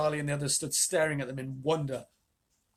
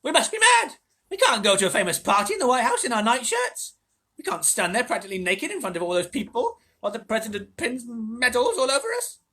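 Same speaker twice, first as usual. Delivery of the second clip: put-on higher and louder old-lady alarm; panicked; 9 semitones higher, 8 dB louder than usual. A narrator's reading. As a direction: fret in frantic dismay. Grandma Josephine panicking.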